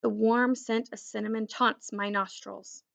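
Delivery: usual speaking voice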